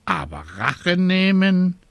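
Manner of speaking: rising high questioning bird voice; dubious raven. Acted. Raven doubting the witch's revenge, high questioning.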